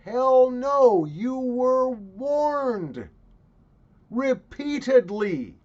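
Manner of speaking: loud, high, drawn-out indignation; furious comic scolding; ranting rebuke. Acted. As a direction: bellow it slowly, stretching each key word. Comic rant at the suing parents.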